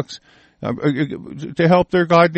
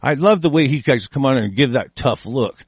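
ordinary talk, nothing marked

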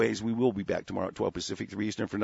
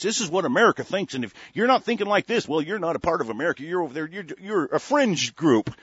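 higher, sneering newscaster parody; mock pundit voice; 6 semitones higher, 4 dB louder than usual. Radio host mocking TV pundits in a higher sneering voice.

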